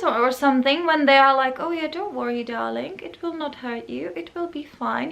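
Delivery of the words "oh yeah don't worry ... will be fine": higher, much softer sugary killer voice; creepy killer sweetness. Retold or imitated. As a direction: coo it like a film killer about to strike. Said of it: "these films with those serial killers".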